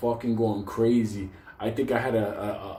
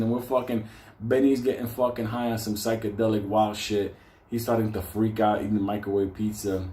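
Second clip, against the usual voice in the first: ordinary talk, nothing marked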